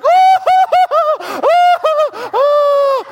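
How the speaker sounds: very high, loud mock "ooh"s; hammed-up wail. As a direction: overact the pain of a tiny tap. Daughters' exaggerated reaction to tap.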